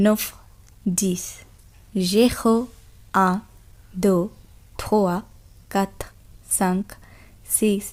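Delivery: soft, careful French counting for learners; model French pronunciation. Shown as a demonstration. Teacher counting zero to ten to students, soft and careful. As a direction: say each number cleanly, pausing between. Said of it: "teacher models French numbers".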